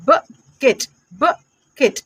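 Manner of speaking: बकेट split as "buh... ket"; slow, two-piece phonics reading of बकेट; sounding out. Shown as a demonstration. Teacher sounds out word in parts. (बकेट को "ब-केट" तोड़कर बोलें)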